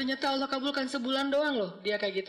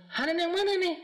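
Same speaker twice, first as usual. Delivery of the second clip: surprised question; 9 semitones higher, 3 dB louder than usual. Retold or imitated. Stranger surprised at the name.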